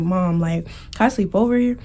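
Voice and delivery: ordinary delivery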